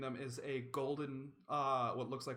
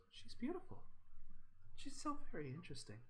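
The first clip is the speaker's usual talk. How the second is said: soft; 3 semitones higher, 9 dB quieter, faster than usual. Acted. Other daughter pointing at the axe, soft and fascinated. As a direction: murmur in quiet fascination. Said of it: "you just hear this soft".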